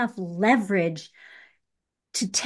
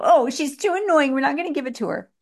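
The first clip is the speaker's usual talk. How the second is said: higher, quick imitation of a fed-up employer; mock exasperation; 6 semitones higher, faster than usual. Coach mimics bosses rejecting a pushy client, mock exasperated. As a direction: play an employer put off by a pest. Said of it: "gonna make them go".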